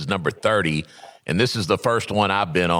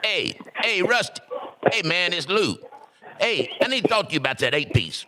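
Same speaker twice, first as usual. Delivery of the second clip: Uncle Lou impersonation; 10 semitones higher than usual. Shown as a demonstration. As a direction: put on a friend's voice, pitched way up. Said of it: "my Uncle Lou impersonation".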